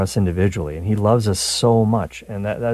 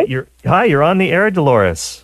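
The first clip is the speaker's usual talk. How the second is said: bright, much higher and louder; excited greeting; 8 semitones higher, 5 dB louder than usual. Real, not acted. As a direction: greet the caller with energy. Host greets caller on the air.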